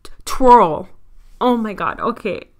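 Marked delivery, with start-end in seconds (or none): 0.0-0.9 "twirl" stumbled into a tr sound, like "troll"; mispronounced twirl. Real, not acted. Podcaster attempting a word she can't say, "twirl" garbled. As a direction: stutter the t, then blurt "twirl" with the w lost. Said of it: "I can't say the word".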